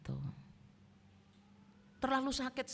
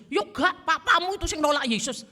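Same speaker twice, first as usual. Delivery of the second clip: higher, louder retort as the offended mother; stubborn mother; 7 semitones higher, 5 dB louder than usual. Retold or imitated. Preacher voicing his mother refusing to reconcile, higher and louder. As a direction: snap back as the hurt, stubborn wife.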